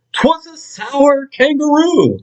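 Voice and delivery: high, slow sniffy kangaroo voice; sour kangaroo. Acted. Sour kangaroo introducing herself, high and haughty. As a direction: drawl it with a turned-up nose.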